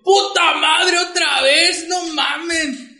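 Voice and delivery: high, drawn-out indignant yell; hammed-up outrage, stretched at the end; retold exasperated cry. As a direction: imitate someone fed up and yelling. Retells Shea spat on a second time.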